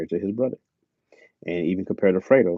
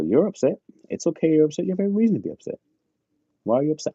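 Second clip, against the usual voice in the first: higher, gently reassuring self-talk; mild self-soothing voice; 8 semitones higher, faster than usual. Retold or imitated. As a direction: talk yourself down kindly. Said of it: "I just go".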